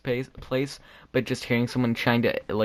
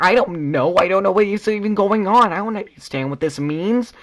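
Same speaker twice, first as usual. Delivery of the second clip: high, loud, hammed-up helpless protest; acting out a cornered student's outburst; mock flustered panic; 9 semitones higher, 6 dB louder than usual. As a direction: plead ignorance in an exaggerated panic. Acts out himself flustered in class.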